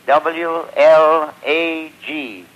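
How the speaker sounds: ordinary talk, nothing marked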